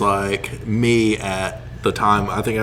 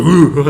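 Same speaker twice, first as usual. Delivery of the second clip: strained ooh; 4 semitones higher, 7 dB louder than usual. Retold or imitated. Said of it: "And he's like".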